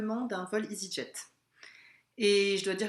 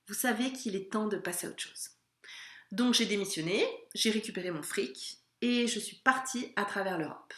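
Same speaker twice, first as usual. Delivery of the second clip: ordinary delivery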